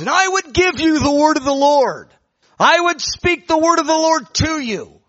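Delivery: high, loud, stretched-out proclamation; prophesying woman. Retold or imitated. Little old woman announcing God's word, loud prophetic cry. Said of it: "started prophesying. She goes".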